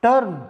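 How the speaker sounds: टर्म with the er sound; model pronunciation. Shown as a demonstration. Teacher to students, demonstrating the er vowel. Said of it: "ये है इस sound के example".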